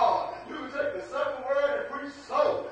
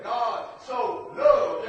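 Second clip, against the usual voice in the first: "God so loved" drawn out very slowly, loud and shouted on loved; preacher shouts "God so loved" to show its intensity, slow and loud; intense stress on so loved; 8 dB louder, much slower than usual. Shown as a demonstration. To the congregation, showing intensity: "God so loved" shouted slowly. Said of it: "Look, look at the intensity of it".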